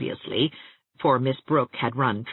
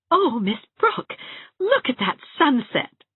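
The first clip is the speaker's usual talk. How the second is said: rapturous; 11 semitones higher than usual. A narrator's reading. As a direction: gasp it out in delight at the sky. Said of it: "said Anne rapturously".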